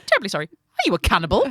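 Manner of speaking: loud, hammed-up apologetic enquiry; mock British politeness. Brit questioning a suspect stranger, mock politeness. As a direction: ask it with exaggerated awkward courtesy. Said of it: "'cause you can't go".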